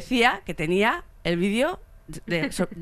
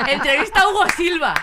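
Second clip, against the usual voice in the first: loud, high headline announcement; excited headline; 9 semitones higher, 8 dB louder than usual. Retold or imitated. Proudly announcing her fake school paper scoop, loud and high. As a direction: trumpet it like a scoop. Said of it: "O sea".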